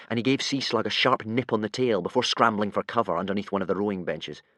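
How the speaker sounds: usual speaking voice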